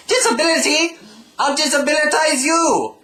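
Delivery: high, loud mock outrage; loud squeaky indignation; offended threat. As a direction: snap back, hammed-up indignant.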